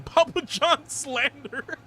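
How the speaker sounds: slow, high words through laughter; laughing. Real, not acted. Laughing at own Papa John's joke to chat. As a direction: laugh through it, amused at yourself. Laughs after joking about lemon pizza.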